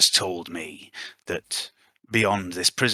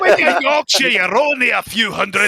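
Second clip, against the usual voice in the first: put-on Yorkshire accent; 12 semitones higher, 7 dB louder than usual. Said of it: "practice my Irish accent".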